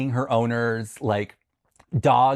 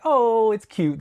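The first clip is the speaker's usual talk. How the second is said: high, louder doting coo; imitated cooing; 11 semitones higher, 5 dB louder, slower than usual. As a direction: gush as over a cute sleeping dog. Cooing over a dreaming dog.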